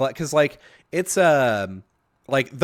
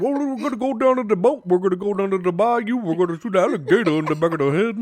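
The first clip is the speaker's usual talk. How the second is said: higher, put-on Cajun swamp accent; Troy Landry; 6 semitones higher, 4 dB louder, faster than usual. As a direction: lay on a thick Cajun accent. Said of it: "he gets on camera and he's like".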